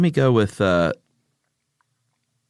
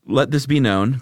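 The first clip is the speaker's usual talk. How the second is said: ordinary talk, nothing marked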